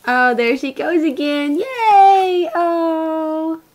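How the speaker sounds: high cooing cheer. Real, not acted. Mother cheering as her baby smiles, high delighted cooing.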